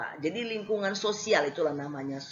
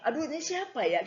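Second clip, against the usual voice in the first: puzzled phone answer; 8 semitones higher, faster than usual. Retold or imitated.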